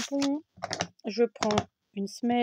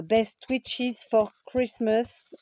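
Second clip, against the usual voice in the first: slow English with a thick French accent; bad French accent; much slower than usual. Real, not acted. Crafter reading a stamp's English greeting, bad French accent. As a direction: read the English slowly in a clumsy French accent. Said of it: "avec mon accent pourri".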